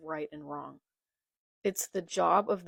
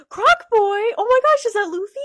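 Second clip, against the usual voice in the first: much higher, much louder, fast thrilled exclamation; excited gasp; 13 dB louder than usual. Real, not acted. Fan spotting Luffy on the page, gasping with joy. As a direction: gasp and blurt it out in delight.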